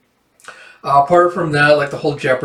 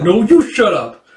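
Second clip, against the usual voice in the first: put-on raised-pitch childish comeback; mock squabbling; 6 semitones higher, 4 dB louder than usual. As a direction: throw it back in a playful acted quarrel. Acting back-and-forth squabble.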